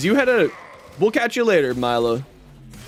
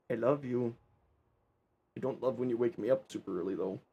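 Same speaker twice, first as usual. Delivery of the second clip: much softer, hushed cooing to the cat; 7 semitones lower, 12 dB quieter than usual. Real, not acted. Streamer coos softly to his grumpy cat, hushed. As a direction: coo quietly over the fluffy cat.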